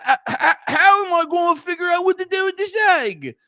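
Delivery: high, strained stammering Harry; hammed-up high-pitched boy hero; flustered film Harry. Acting out Harry puzzling over the egg.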